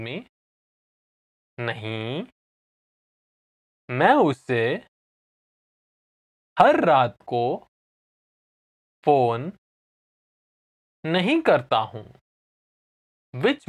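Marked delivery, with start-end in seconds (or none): 1.6-12.0 slow teaching pace, long gaps between phrases; slow repeat. Shown as a demonstration. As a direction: say it slowly, chunk by chunk. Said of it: "I'll say it again slowly".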